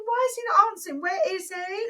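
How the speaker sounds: high, agitated inner voice; retold exasperation. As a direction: fret at an absent partner, re-enacted. Retold panic while nobody answers.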